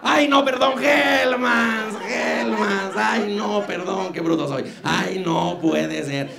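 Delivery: shrieking high self-correction, then calming down; flustered high-pitched mock correction. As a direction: [name] imitating his own on-air blunder, shrill then fading. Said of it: "cuando dije mayonesa McCormick".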